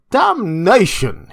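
slow, loud angry curse; exclaimed. A narrator's reading. Drunk doctor cursing his companion, loud and slow. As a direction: bark the curse in exasperation. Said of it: "he exclaimed at some petulance".

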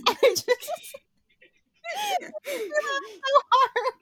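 high squeal, talking through laughter; can hardly get the words out laughing; helpless laughter. Real, not acted. Cracking up after the impression.

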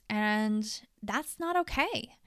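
normal talking voice

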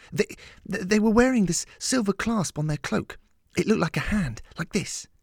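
high-pitched, stumbling excited recollection; eager girl, excited. A narrator's reading. Lillian describes the attackers' clasp to her mentor, excited.

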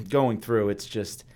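usual speaking voice

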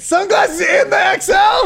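high, loud, stretched-out shout; mock yelling. Friend mocking passenger's look in the car, mock yelling. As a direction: holler in mock outrage, dragging the words.

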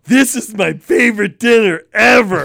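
put-on high, loud excited kid voice; a toddler shouting her joy over chicken tenders; delighted toddler. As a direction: squeal it like a thrilled little girl. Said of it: "about three minutes in".

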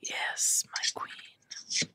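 hushed, obedient whisper; whispered servant reply. Pretend. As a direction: whisper it like a devoted servant to a queen. Playing servant answering the queen.

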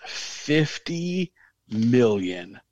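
very slow, weighty emphasis; drawn-out awe. Real, not acted. Host marvels at a player's net worth, very slow and drawn out. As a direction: stretch out each word to let the number land.

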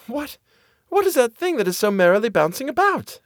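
put-on high, wide-eyed girlish voice; cartoon princess. A narrator's reading. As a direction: chirp it out with childlike wonder. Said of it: "asked the girl".

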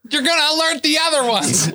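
high-pitched, laughing mock yell; mock screaming. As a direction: squeal a hushing warning at the party. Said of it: "screaming at each other to be quiet".

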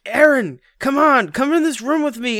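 high, loud calling out to a friend; calling out to Aaron. Retold or imitated. Mock pleading with a friend to come into the room, high and loud. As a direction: yell him into the room. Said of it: "Dave England's going".

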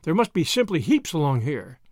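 ordinary delivery, nothing marked

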